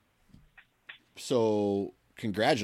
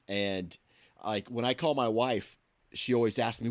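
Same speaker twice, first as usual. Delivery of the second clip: ordinary delivery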